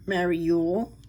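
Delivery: ordinary talk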